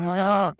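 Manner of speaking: short, high, loud yell of Ah; a sharp startled scream, half reenacted; retold scream. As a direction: relive waking from the nightmare with a cry. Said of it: "I wake up screaming, and I'm going".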